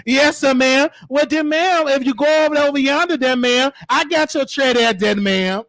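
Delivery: deferential driver-and-maid act; mock servant. Caregiver serving a resident's tray, mock servile voice. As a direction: rattle off yes-ma'ams like a film servant. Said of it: "act like a maid or a slave".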